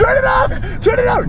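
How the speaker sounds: high shout, half laughing in panic; yelling to stop. Real, not acted. Panicked demand to stop camera.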